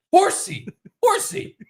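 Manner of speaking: put-on very high, squeaky kid voice; imitated mesmerized kid. Parent imitating kid mesmerized by horse, high squeaky voice. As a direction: squeal it like an amazed toddler.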